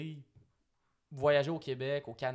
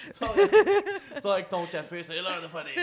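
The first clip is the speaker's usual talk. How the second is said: laughing; 5 semitones higher, 10 dB louder than usual. Pretend. Teasing trailer-park scenario, laughing.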